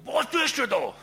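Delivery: loud, high, accusing shout; angry yell. Retold or imitated. Angry local yelling at the photographer, loud and high. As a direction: bark it at the stranger as an angry local.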